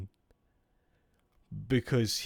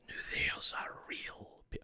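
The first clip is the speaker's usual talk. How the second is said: hushed, cut-off whisper; whispered aside; 10 dB quieter than usual. Real, not acted. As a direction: whisper it to yourself and break off.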